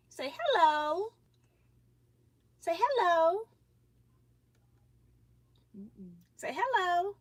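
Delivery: high sing-song prompting. Real, not acted. Prompting baby to greet.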